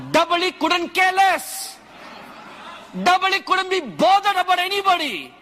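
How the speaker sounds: loud, high, laughing fire-and-brimstone preaching; preaching at full force. Acted. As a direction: shout it out to the congregation, laughing with zeal.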